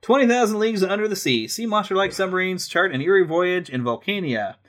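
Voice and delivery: brochure read, lightly dramatic; reading aloud. Acted. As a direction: read it like guidebook copy. Reads aloud from a guidebook.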